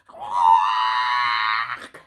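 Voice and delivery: loud squawking cry; penguin squawk. Shown as a demonstration. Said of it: "squawk real loud".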